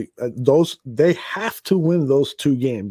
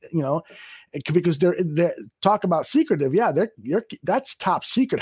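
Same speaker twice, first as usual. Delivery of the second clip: normal talking voice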